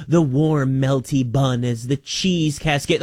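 imitating a commercial's food description, drawn out; mock whispery food ad. Host mimics food commercials he can't stand, mock whispery ad voice. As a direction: linger on each word like a food advert. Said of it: "describes food in a whispery way".

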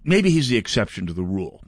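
usual speaking voice

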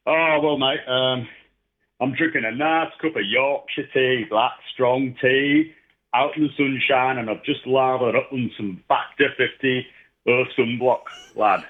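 playing up a cheery Yorkshire lad; Yorkshire accent. Host asks if it was Yorkshire, he hams up Yorkshire. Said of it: "Was that Yorkshire or Scottish".

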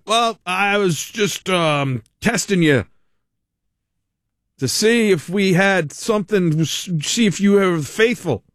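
imitating a failed prophet backpedaling slowly; mock cult leader making excuses. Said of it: "That's what it's like".

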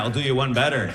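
usual speaking voice

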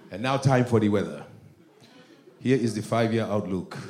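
put-on low, measured African-accented forecast; African weatherman. Weatherman in Africa giving outlook, low African accent.

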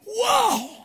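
a big man's mock-surprised whoa; playful imitation of a giant reacting. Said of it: "Can you imagine [name] going".

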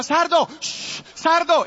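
put-on panicked boy yelling the horse's name; calling to the horse. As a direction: beg the runaway horse to stop. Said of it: "Y yo le hacía".

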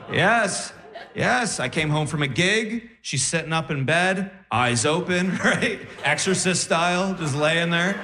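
ordinary talk, nothing marked

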